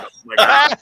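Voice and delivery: high and loud, gushing in mock rapture; mock ecstatic Liefeld. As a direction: ham up a man in love with his own smell. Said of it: "and be like".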